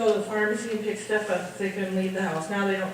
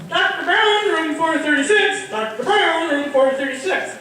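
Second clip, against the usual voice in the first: mock paging announcement, a bit louder and higher; intercom page; 6 semitones higher, 5 dB louder than usual. Operator paging over the intercom, lightly mock page. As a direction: call it out like a hospital page. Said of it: "you can hear over the intercom".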